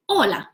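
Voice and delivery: Hola said clearly, ending on a falling tune; model hola. Shown as a demonstration. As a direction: repeat "Hola" as the course teaches it. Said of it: "We say".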